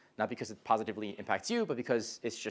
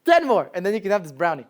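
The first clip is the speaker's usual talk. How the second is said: coaxing friend; 7 semitones higher, 9 dB louder than usual. Retold or imitated. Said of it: "He'd be like".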